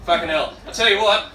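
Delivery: normal talking voice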